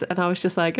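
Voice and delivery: normal talking voice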